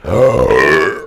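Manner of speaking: loud mock-shocked gasp; sharp gasp. Real, not acted. Gasp at friend's remark.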